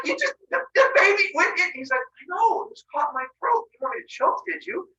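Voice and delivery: high indignant bickering; shocked squabble. Acted. Wife and husband bickering over the baby, high-pitched. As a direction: argue in mock shock.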